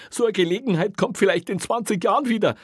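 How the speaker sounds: very high, loud wailing whimper; whining plea. A narrator's reading. Officer begs his boss not to spoil his evening, whining. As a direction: whine desperately, high and pitiful. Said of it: "winselte Kreutner".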